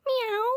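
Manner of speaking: a short playful meow; cat meow. Acted. Girl in a cat costume playing, meowing.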